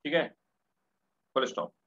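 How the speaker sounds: very slow, repeated dictation phrases. Shown as a demonstration. Teacher dictating reptile traits to the class, very slowly. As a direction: drag out each phrase so students can copy. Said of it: "dictating notes to class".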